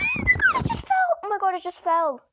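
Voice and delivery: very high, loud shriek of dismay; shocked shriek. Real, not acted. Vlogger's toy stack just collapsed, loud shocked shriek. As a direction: shriek as it all crashes down.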